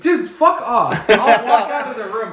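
loud, high, laughing protest; half-laughing plea to stop; laughing outburst. Real, not acted. Friend begs storyteller to stop.

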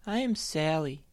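lightly voiced gloomy bunny; sad Sally. A narrator's reading. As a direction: sigh it out like everything is sad. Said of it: "responded Sally".

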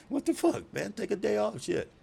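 high, exasperated plea to a workaholic; baffled protest. Retold or imitated. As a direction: voice leaps way up in mock disbelief. Voicing a protest to workaholic friend.

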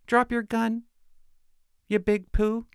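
a light parody of a too-gentle officer; cutesy. Pretend. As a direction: say it sweet and silly, like to a child. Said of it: "talk all cutesy".

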